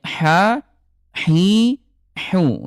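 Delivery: "ha, hi, hu" with a hollow mid-throat h; hollow ha sounds; throaty ha. Shown as a demonstration. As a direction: breathe "ha, hi, hu" from the throat. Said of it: "keluarnya di tengah tenggorokan".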